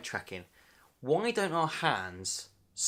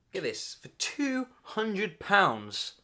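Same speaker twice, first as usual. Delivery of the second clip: slow and higher, stunned at the price; incredulous reveal; 6 semitones higher, much slower than usual. Acted. Telling viewers the price, incredulous. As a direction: spell out the shocking price.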